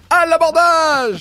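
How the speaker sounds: high loud slow pirate cry; Viking battle cry. Pretend. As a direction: roar it like a raiding Viking.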